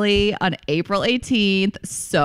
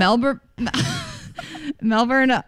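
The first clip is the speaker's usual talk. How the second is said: Melbourne said higher, breaking into laughter; laughing attempt; 8 semitones higher than usual. Real, not acted. Host attempting a city name discreetly, laughing. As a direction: try Melbourne carefully and crack up. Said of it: "trying to, like, say it so quietly".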